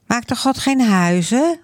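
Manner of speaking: put-on curious child. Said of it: "vraagt Maaike".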